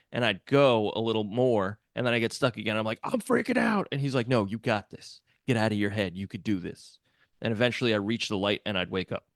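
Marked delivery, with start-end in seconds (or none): 3.0-3.9 high, tight panic, pitched way up; panicked. Retold or imitated. Stuck again in the cave, telling the pig he's freaking out, high panic. 4.3-7.0 calm coaching. Retold or imitated. Pig calms him down.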